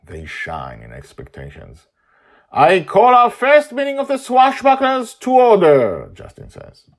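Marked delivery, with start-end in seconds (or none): 2.5-6.1 formal proclamation. Acted. Father formally opens meeting.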